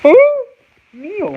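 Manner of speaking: very high, drawn-out "O" in "O'Neal"; exaggerated high phonetic "O'Neal"; sounding it out. Shown as a demonstration. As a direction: squeal the "O" of "O'Neal", then drop. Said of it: "Is that how you pronounce it".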